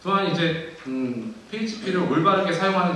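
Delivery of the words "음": ordinary talk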